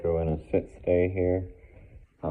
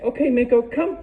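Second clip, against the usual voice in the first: high, loud, bright release call; excited recall; 10 dB louder than usual. Real, not acted. Trainer calling the dog out of its stay, excited recall.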